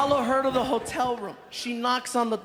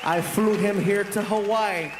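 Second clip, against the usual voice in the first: showman announcer; 5 semitones lower than usual. Acted. Host presenting his friend to the crowd, grand announcer build-up. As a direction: hype the guest like a host. Formal stage introduction.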